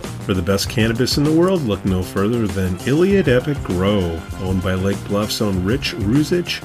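put-on bright old-time radio commercial; chipper 1950s ad. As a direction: pitch it like a fifties announcer. Sponsor ad read over music.

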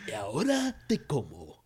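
lightly voiced creepy monster threat; eerie monster voice. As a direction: threaten like a hungry faun. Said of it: "That guy had the most eerie vo".

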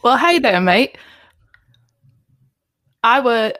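loud put-on English pub voice; English character voice. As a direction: play a cheery Brit calling out. Improv scene, English character voice.

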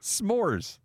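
"S'mores" with a clean s-m, no H; correcting pronunciation. Shown as a demonstration. Co-host corrects the host, "S'mores" with no sh. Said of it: "There's no H in there".

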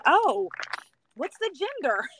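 high-pitched retold surprise; acted-out startled question; sudden realisation. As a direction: jump up high as if it just dawned on you. Retelling sudden realisation after birth.

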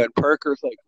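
ordinary talk

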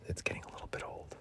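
fast hushed whisper. Pretend. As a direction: hiss it under your breath. Whispered aside to himself.

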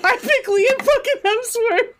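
high, loud, laughing outburst; incredulous laughing shout. Real, not acted. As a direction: shriek it through real laughter. Reveals the identical pick.

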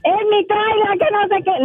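mocking the man. [name] mimics Jorge claiming his trailer, mocking whine. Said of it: "que se llama Jorge el señor".

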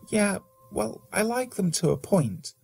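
light female character voice; lightly voiced woman, teasing; Jenna's voice. A narrator's reading.

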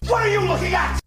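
high-pitched yell; mock scream. Teller imitates a man screaming at a group of kids, mock scream. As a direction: scream it like someone going off on kids. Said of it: "he just screams".

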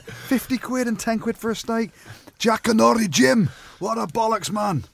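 high, slow, mocking rant. Kelly Brown ranting about the steak price, high mock outrage. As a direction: ham up an indignant friend going mad. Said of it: "He goes mad".

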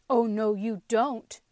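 loud, thick-throated pumpkin; a throaty, lightly voiced pumpkin taunt. A narrator's reading. Pumpkin escaping the boy, thick throaty voice. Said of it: "laughed Pumpkin in a thick, throaty sort of voice".

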